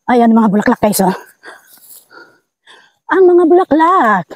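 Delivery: breathless, panting between words; out of breath from the climb; hyperventilating. Real, not acted. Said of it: "Sensya na, hiningal tayo".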